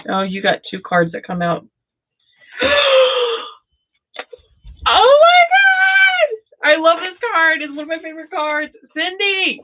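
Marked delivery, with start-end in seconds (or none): 2.5-3.5 loud, high gasp; sharp gasp. Real, not acted. Reader sees Cindy's card come out, sharp excited gasp. 4.9-9.6 high, thrilled outburst; real excitement. Real, not acted. Reader sees a favorite card for Cindy, real excitement. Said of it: "I get so excited".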